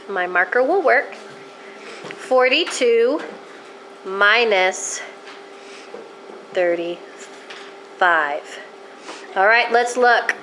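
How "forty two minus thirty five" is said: slow thinking aloud. Shown as a demonstration. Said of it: "teacher writes, thinking aloud".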